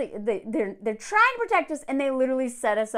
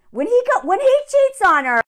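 sharp, high, loud outrage at him; indignant outburst; 10 semitones higher, 9 dB louder than usual. Real, not acted. Ranting to viewers about a cheater, loud indignant outburst. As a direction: blurt it out, scandalized.

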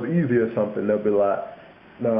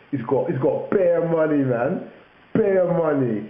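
higher mock London lad; London slang; 5 semitones higher than usual. Telling a mate about someone's money, mock London accent. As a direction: imitate an impressed Londoner, pitched up. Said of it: "They say bear".

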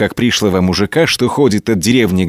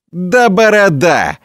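high, drawn-out jeering exclamation; mocking foreman's hoot, stretched out; mock guffaw; 9 semitones higher, 3 dB louder, slower than usual. A narrator's reading. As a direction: crow it slowly, high, as a big joke. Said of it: "гогочет артельщик".

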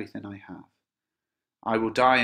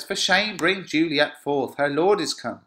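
raised, loud theatrical order; impatient command; 6 semitones higher, 7 dB louder than usual. Acted. Capulet wanting Juliet brought forth, impatient order. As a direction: scold and command the household.

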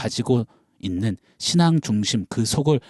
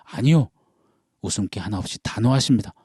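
normal talking voice

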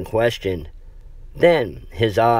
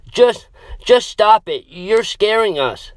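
high, loud upset yell; 12 semitones higher, 4 dB louder than usual. Acted. Samantha yells at the boys to stop scaring her. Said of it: "she said".

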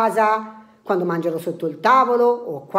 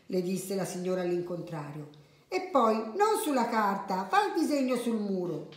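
soft storyteller with lively character lines; children's story narration; 6 dB quieter than usual. A narrator's reading. Adult reading a funny tale to children, animated storytelling. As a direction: read it gently, acting out each line.

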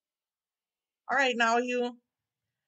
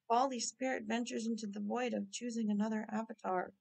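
dramatic narration; 2 semitones lower, 6 dB quieter than usual. Acted. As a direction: intone it like a mystical narrator. Narrating avatar change.

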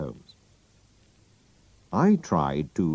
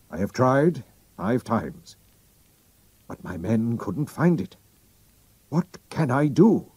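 a hint of a proud royal, pressing; drawing himself up, then urging secrecy; lightly voiced king; 6 semitones higher than usual. The king reveals his name to Holmes, lightly voiced.

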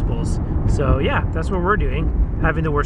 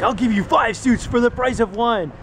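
radio ad guy; 8 semitones higher than usual. Retold or imitated. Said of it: "he's always like".

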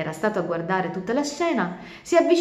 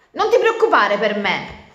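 louder, higher irritated turtle; annoyed; 4 semitones higher, 9 dB louder than usual. Acted. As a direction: brush off her worry, irked. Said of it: "infastidita da queste parole". (tartaruga infastidita)

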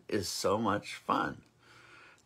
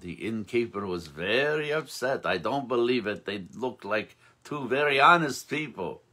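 put-on storyteller's Yiddish accent; 2 semitones higher than usual. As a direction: spin the old rebbe legend in a gentle Yiddish lilt. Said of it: "it's fun to do the accent".

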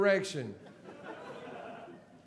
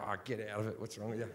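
awkward Aussie bloke deflection; 9 semitones lower, 10 dB quieter, much faster than usual. Retold or imitated. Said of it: "that Australian men have where we go".

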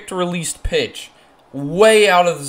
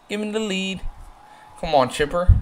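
ordinary delivery

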